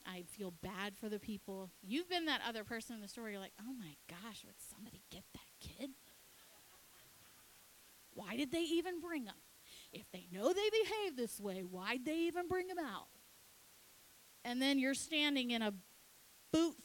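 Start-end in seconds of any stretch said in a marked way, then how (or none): 3.6-5.9 hushed, disapproving aside; quick, half-whispered mock complaint; judgmental onlooker. Imagined onlooker grumbling in store. 8.1-13.1 higher-pitched mock complaint; mildly hammed-up disapproval; indignant onlooker. Imagined onlooker complaining about parents.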